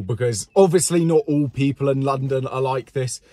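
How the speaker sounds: ordinary delivery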